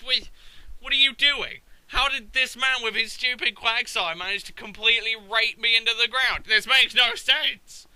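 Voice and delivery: loud, high baffled protest; worked-up retold indignation; exasperated rant. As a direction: rail at a senseless defeat. Ranting at opponent's baffling move.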